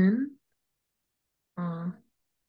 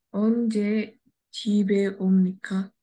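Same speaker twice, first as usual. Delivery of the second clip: brisk, joined-up reading; faster reading; much faster than usual. Shown as a demonstration. Student rereading fast for the teacher, quick and connected. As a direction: read it through quickly in one go. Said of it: "read it fastly again".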